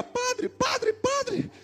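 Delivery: high, soft little lady's voice; imitated little old lady. Little old lady calling the priest after the sermon, imitated high voice. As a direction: call out in a thin high mimicry, softly. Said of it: "vem uma senhorinha".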